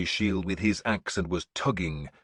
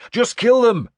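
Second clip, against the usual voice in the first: much higher and louder yell; shouted order; 10 dB louder than usual. A narrator's reading. Leader quickening the charge, loud order. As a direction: hurl the order ahead. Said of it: "I shouted".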